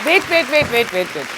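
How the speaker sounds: loud, rapid repeated interruption; starts loud, pitch dropping on the repeats; calling a halt. Real, not acted. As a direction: cut in loudly to stop the reader. Host interrupts the letter reading.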